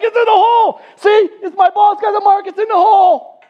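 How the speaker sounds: high-pitched, loud celebrating shout; wildly excited. Retold or imitated. Golfer showing friends his marked ball, wildly excited. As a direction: act out going crazy with excitement. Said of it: "He's so excited. He's like".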